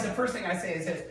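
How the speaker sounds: normal talking voice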